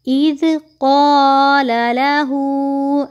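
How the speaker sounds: slow chanted recitation, heavy qaf; stretched tajweed chant; Quranic recitation. Shown as a demonstration. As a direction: recite slowly and melodically. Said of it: "नहीं पढ़ना".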